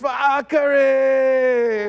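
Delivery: high, loud rallying shout; rally the troops with a cry; battle cry. Acted. Actor ending the Draka reading with a battle cry.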